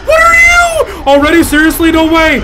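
high, loud shocked yells; excited shouting. Real, not acted. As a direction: blurt it out in total disbelief. Shocked streamer reacts to monster.

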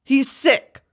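usual speaking voice

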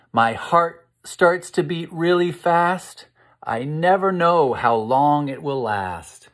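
dramatic rhyme reading. A narrator's reading. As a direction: read it like the anger is rising. Reads the tense verse.

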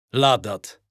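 luid bevel van een koning, iets lager; bevelende Mufasa. Acted. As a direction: streng en kort bevelen als de leeuwenkoning. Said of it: "beveelt Mufasa". (bevelend, luider uitgesproken)

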